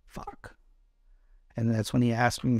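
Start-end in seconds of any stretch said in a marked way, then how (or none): 0.0-0.5 muttered curse. Retold or imitated. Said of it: "I'm like, on the satellite phone".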